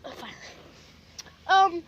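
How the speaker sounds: normal talking voice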